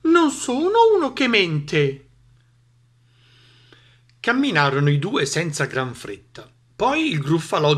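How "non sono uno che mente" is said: high, slow, self-assured mouse. A narrator's reading. Mouse vouches for its honesty, high-voiced assurance. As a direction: pipe it up proudly, taking time. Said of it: "il topo rispose".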